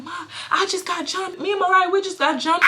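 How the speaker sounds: acting out a winded, upset report; catching breath, retold. Daughter telling mom she got jumped, trying to catch breath. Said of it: "trying to catch my breath and my voice".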